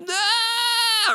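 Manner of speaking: put-on high wailing baby cry; a high mock baby's wail; imitated baby cry. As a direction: wail like a hungry infant. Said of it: "They can".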